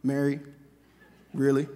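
low, deadpan disbelief; mock exasperated. Jesus to Mary who took him for the gardener, deadpan. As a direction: drop low, dry and unimpressed. Acts Jesus reacting to Mary's mistake.

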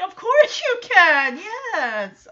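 high, loud acted-out yes; gushing agreement. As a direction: burst out with an emphatic, high yes. Host acts out gushing yes.

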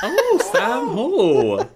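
high, long-drawn exaggerated "oh"; mock indignation. Co-host reacting to the listener who questioned him, mock indignant. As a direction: stretch the name out in playful outrage.

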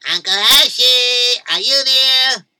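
slow, loud, high call. Acted. Boy calling out for his uncle at the farm, loud.

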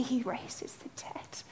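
soft whispered lyric. Retold or imitated. Preacher whispers the lyric in awe. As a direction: hush it almost to nothing.

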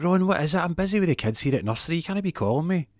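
high-pitched, harried wife voice; exasperated wife. Retold or imitated. Busy wife scolds Ron for calling, exasperated. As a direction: put on a high, fed-up voice.

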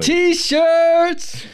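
high, loud excited whoop; excited shout. Real, not acted. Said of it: "Got excited".

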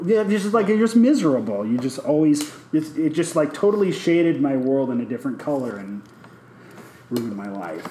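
usual speaking voice

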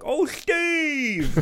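retold squealed hello; excited greeting. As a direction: greet him with mock delight. Retells greeting Steve excitedly.

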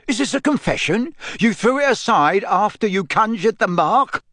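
accusing. A narrator's reading. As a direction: turn the accusation on the boy. Diggory accusing Harry.

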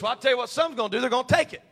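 high, loud, forceful preaching. Real, not acted. Preacher warning some will take the mark, shouted preaching. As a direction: drive it home from the pulpit.

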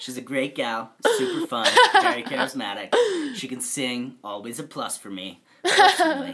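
overexcited matchmaker plugging a friend; mock hype ad. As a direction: sell her like a game-show host. Friend hypes her as a date.

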